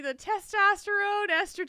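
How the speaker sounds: laughing about testosterone. Real, not acted. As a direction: laugh out the words. Joking about boss.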